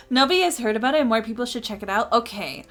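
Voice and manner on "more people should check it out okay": ordinary talk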